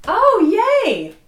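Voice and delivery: excited squeal of delight; sudden happy yelp. Real, not acted. First sip, delighted reaction.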